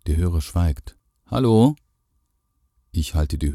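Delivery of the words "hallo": calling hello. A narrator's reading. Caller stays silent.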